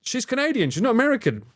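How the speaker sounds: high, mocking retort voice; indignant correction of a friend; 1 exasperated retort. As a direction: snap back in a high mock voice. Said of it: "I'm like".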